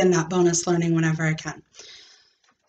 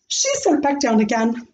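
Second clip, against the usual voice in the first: ordinary delivery, nothing marked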